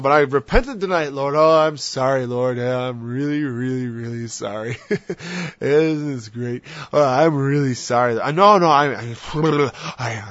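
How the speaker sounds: loud, overdone sorry; mock apology. Preacher mocks a sinner's cheap apology, loud and hammed-up. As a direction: ham up the empty apology. Mocking a careless repenting sinner.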